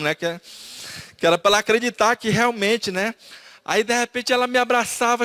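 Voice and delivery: usual speaking voice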